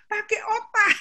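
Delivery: retold scolding. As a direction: snap it out like a sharp rebuke. Scolding grandchild in retold scene. (dengan suara keras dan tinggi)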